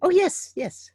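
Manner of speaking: ordinary delivery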